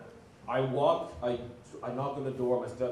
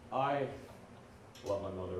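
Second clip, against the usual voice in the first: ordinary talk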